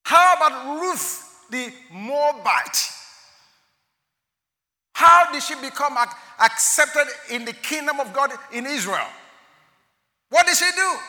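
high, loud, slow preacher's cry; preaching at full force. Acted. Preacher rousing the congregation with loud questions. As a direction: drive each question home to the hall.

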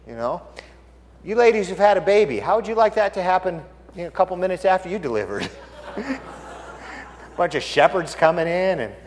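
ordinary talk

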